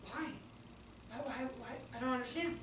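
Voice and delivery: put-on very soft, puzzled child; imitated whisper. Boy told the loft is off limits, imitated soft whisper. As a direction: whisper the questions under your breath. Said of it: "when you've already showed three whispers".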